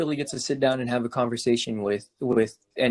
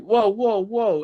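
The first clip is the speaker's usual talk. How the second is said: retold startled whoa; 7 semitones higher, 4 dB louder, slower than usual. Friend reacting to insult.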